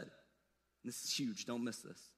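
very soft, slow emphasis; hushed aside. Real, not acted. Preacher to congregation, hushed and urgent. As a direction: lean in and almost whisper.

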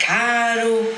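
fake Rio accent. Shown as a demonstration. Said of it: "tem que dizer".

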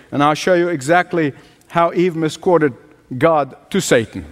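ordinary talk

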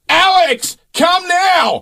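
high-pitched urgent call across the street. Retold or imitated. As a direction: yell the name from outside, high and sharp. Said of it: "and then I just hear".

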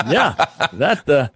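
real laughter through a happy reply; laughing. Real, not acted. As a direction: answer while laughing in delight.

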